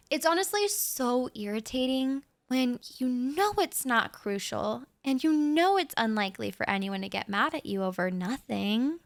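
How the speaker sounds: usual speaking voice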